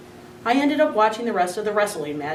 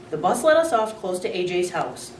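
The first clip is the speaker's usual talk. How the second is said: ordinary delivery, nothing marked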